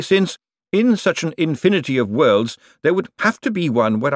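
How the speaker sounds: high, scornful banker voice; withering put-down. A narrator's reading. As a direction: cut him down with sarcasm. Bent's sarcastic rebuke of Moist.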